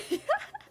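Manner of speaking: quick high laugh; high giggle. Real, not acted. Streamer laughing after daring chat, high giggle.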